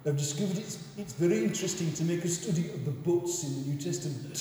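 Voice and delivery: put-on a light Yorkshire accent, half-laughing. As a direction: imitate a Yorkshire colleague giving devotions. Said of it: "I'll do his accent as well".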